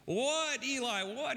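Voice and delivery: a mild mock whine from a woken child; exasperated boy. Boy woken a third time.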